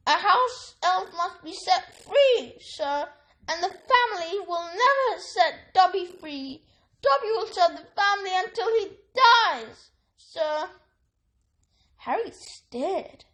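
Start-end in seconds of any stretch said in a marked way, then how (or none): 0.1-10.7 high, anxious elf voice; a loyal servant confessing; house elf. A narrator's reading. Elf explains his family to Harry, high. Dobby voice.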